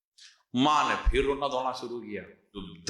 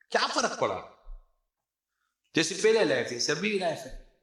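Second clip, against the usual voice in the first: raised, teasing jab at the wife; mocking taunt; 5 semitones higher than usual. Husband laughing at his wife, mocking taunt. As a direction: needle her with a sneering question.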